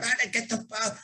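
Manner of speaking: high but soft imitation of cursing; garbled, high-pitched mock swearing. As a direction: imitate someone cursing, without the volume. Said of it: "he starts cursing out".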